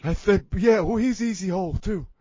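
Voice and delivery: hammed-up confession in character; Big Johnny voice. Big Johnny confesses to the host, mock regretful character voice. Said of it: "but Big Johnny".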